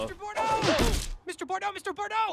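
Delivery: high, rapid repeated calls; eager fan calling. Acted. Fan calls out to the reporter, high and excited. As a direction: clamour for your idol's attention.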